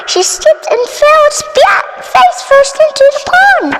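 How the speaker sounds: high excited narration of the splat; dramatic fall. A narrator's reading. Narrator telling Poppy's fall into the pond, high and excited. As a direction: tell the fall with rising drama.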